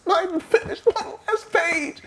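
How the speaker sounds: high laughing protest. Retold or imitated.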